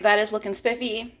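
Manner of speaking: ordinary delivery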